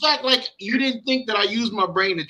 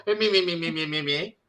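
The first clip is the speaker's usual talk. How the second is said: mock nagging; 6 semitones lower, 10 dB quieter than usual. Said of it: "I didn't go".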